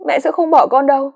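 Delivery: put-on high, pleading girl's voice; cling to hope through tears; tearful denial. A narrator's reading. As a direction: daughter insisting her mother won't leave, high and tearful. Daughter in denial.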